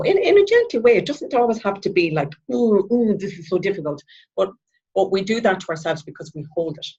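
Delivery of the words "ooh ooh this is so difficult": high whiny oohs, then easing down; mock struggling. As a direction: make a show of finding it hard. Mimics making heavy weather of it.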